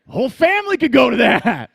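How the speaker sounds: loud, high mock-revelation through laughter; staff-meeting in-joke, quoted with a laugh; mocking a colleague, laughing. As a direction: parrot Denny's light-bulb line and crack up. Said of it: "and I'll go".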